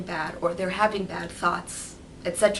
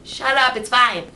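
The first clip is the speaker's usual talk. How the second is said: snapping at herself; 4 semitones higher, 7 dB louder than usual. Retold or imitated. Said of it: "I'm like".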